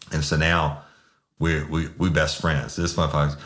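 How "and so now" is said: usual speaking voice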